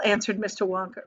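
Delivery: plain reading voice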